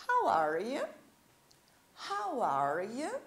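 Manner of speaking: "how are you" dipping, then rising on you; question intonation. Shown as a demonstration. Teacher models the greeting for Brazilian learners, rising question tune on "how are you". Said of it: "nós fazemos a entonação da pergunta".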